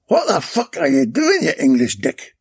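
loud, high, angry Irish yell; agitated shout. A narrator's reading. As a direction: bellow it at the rival in outrage. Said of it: "shouted a very agitated Patrick O'Hanlon".

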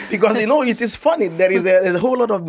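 real laughter breaking into the explanation; amused. Real, not acted. Doctor recalling a bold first meeting, amused and laughing. Laughs while telling the story.

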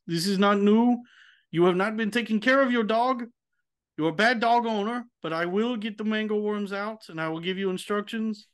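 put-on a German veterinarian putting his questions with a German accent; German vet accent. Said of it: "And the vet would say".

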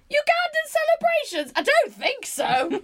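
very high, incredulous, breaking into laughter; mock disbelief. Real, not acted. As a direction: scoff in exaggerated disbelief. Mock disbelief at granddad's claim.

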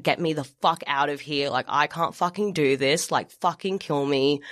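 normal talking voice

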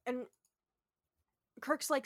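ordinary talk

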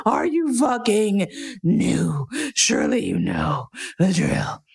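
high-pitched furious rant, slowing down; raging inner rant. Retold or imitated. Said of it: "in my head is this raging, slavering".

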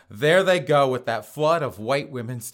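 ordinary delivery

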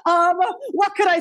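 real high-pitched laughter in the voice; laughing. Real, not acted. Coach trying to resume after cracking up, laughing. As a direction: talk through the tail of a laughing fit.

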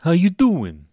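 loud, high New York accent put on; hammed-up New Yorker greeting. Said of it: "do that accent".

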